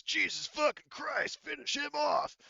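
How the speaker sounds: high, loud exasperated shout; Igor shouting. Acted. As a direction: bellow it, swearing at an ally to finish it.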